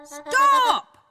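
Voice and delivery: high, loud penguin cry of stop; sharp shout. Acted. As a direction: shout to halt the dancing. Huddle penguin shouts at her.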